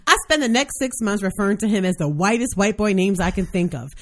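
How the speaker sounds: usual speaking voice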